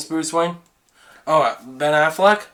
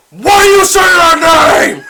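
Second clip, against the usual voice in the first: John from Wasting Money; 13 semitones higher, 9 dB louder than usual. Retold or imitated. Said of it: "how about John from Wasting Money?".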